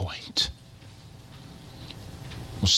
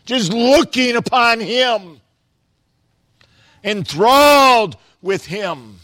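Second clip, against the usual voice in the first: raised, loud voice swelling on key words; exultant preaching; 8 semitones higher, 6 dB louder than usual. Real, not acted.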